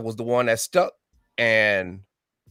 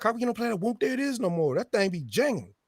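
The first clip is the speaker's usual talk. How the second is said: put-on much higher, playful young woman's voice; girl's voice; 11 semitones higher, 4 dB quieter than usual. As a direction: voice the club girl teasing him. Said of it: "one of the girls like".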